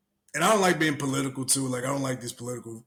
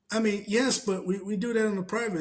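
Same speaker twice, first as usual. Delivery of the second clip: higher, coy admission; sheepish little confession; mock Kamala; 7 semitones higher than usual. Kamala admitting about her husband, higher coy voice.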